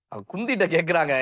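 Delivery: usual speaking voice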